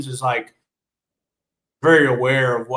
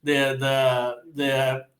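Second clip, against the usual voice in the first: ordinary talk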